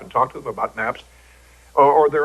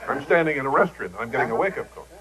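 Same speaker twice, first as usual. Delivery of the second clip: ordinary talk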